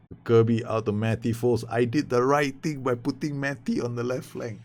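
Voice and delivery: normal talking voice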